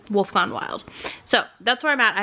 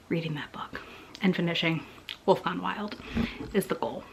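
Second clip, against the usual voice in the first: soft whisper, much softer; 2 semitones lower, 10 dB quieter than usual. Real, not acted. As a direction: drop to a hushed whisper. Drops to a whisper suddenly.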